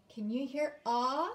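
normal talking voice

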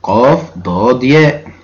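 each letter named slowly and clearly; teacher dictating قاف ضاد ياء slowly; slow spelled out. Shown as a demonstration. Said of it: "وممكن أقول مادة".

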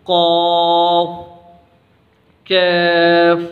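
thick قاف, light کیف; heavy qaf, thin kaf. Shown as a demonstration. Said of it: "یہ قاف موٹا ہے اور یہ کاف باریک ہے".

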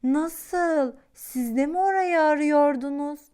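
taken aback; surprised. A narrator's reading. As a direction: dinosaur stunned that they sought the same land. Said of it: "Küçük dinozor şaşırmış".

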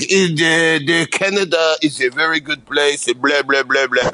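mock French-Canadian accent. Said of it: "he would talk like this".

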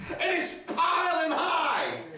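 high, slow comic shout; hammed-up wail of overload; modern-day complaint. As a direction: belt it out, stretched for laughs. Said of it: "we don't use it in modern vernacular".

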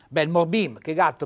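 ordinary talk, nothing marked

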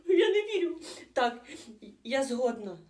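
delighted laugh. Acted. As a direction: laugh in happy disbelief. Accepts marriage proposal.

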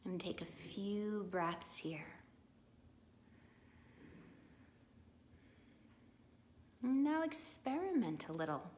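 measured meditation guide with long pauses; guided meditation. Real, not acted. Guiding listeners to imagine a light within, calm meditation voice. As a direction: ease them through the imagery, leaving room to breathe.

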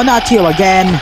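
usual speaking voice

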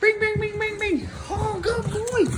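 very high squeaky sing-song chant; squeaky praising a dog. Real, not acted. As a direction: squeal it like a celebration. Celebrating the find.

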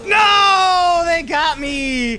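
loud, high dismayed no; shouting. Real, not acted. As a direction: cry out as you die. Killed in game.

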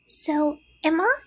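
very high little spider; tiny voice. A narrator's reading. Third spiderling joins in, tiny voice. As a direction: chime in with a squeaky little voice. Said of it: "said another tiny voice".

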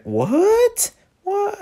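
very high, shocked yelp; high anguished disbelief; shocked cry. Real, not acted. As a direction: cry out in dismay. Shocked at hair cut idea.

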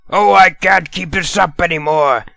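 high, pushed-up blurting; can't hold it in any longer; outburst confession. Acted. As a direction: blurt it out, pitched right up. Villain bursts out with confession.

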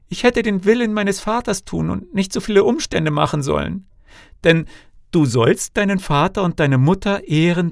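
high-pitched self-reproach, settling at the very end; wailing lament. A narrator's reading. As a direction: bewail your own folly in a high voice. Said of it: "wo sie nicht diese Klagen ausgestoßen hätte".

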